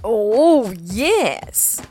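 loud, gleeful fox cry. Acted. Fox greeting his dinner, gleeful cry. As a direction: crow with delight. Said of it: "cried the fox".

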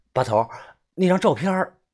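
tentative question rising in pitch; guilty, uneasy asking. A narrator's reading. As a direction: broach it with a touch of guilt. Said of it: "我心虚地问把头". (心虚地试探着问)

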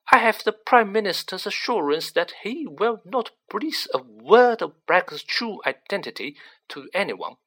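irritable. A narrator's reading. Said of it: "said the irritable Fudge".

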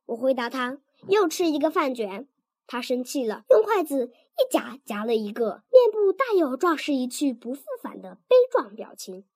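ordinary delivery

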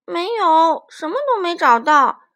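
loud call from the tree; calling back. Acted. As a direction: shout down, disappointed.

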